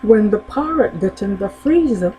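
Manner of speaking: ordinary talk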